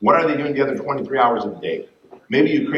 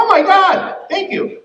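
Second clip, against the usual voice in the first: much higher, louder squeal of thanks; delighted client; 5 dB louder, slower than usual. Retold or imitated. Client receiving the text, squealing higher with delight. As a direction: gasp it out, overwhelmed with joy. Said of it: "They're gonna be like".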